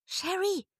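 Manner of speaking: high, loud call from outside. A narrator's reading. Friend calling Sherry from outside, loud call. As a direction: call her name from a distance. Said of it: "rief eine wohlbekannte Stimme".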